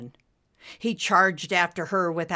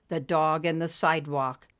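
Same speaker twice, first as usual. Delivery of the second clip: ordinary delivery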